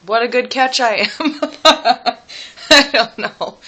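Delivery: laughing at herself. Real, not acted. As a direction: laugh it off, embarrassed. Self-mocking laugh about herself.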